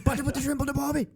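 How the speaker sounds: fast, high, hammed-up Aussie accent; Australian accent. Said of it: "do an Australian accent".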